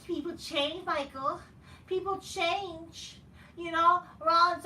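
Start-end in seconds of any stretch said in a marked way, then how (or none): 0.1-3.2 high, whiny mock of Cody. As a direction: mimic him, drawn out and higher. Mocking Cody's speech.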